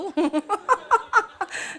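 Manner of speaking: high, loud burst of laughter; preacher laughing loudly. Real, not acted. Laughs at congregation.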